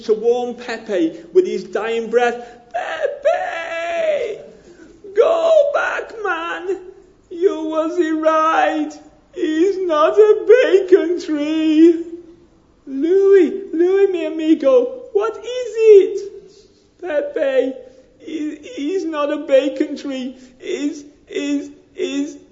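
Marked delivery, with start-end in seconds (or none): 2.7-12.0 very high, crying mock shout, then slow; hammed-up dying warning to his friend; dying cry, Mexican accent. 12.9-16.2 high, loud hammed-up panic; alarmed Mexican accent. Joke friend alarmed. 17.0-22.4 high, slow, broken voice with laughter in it; dying friend gasps out the pun to Pepe; dying Mexican accent. Acted. Dying friend delivers punchline.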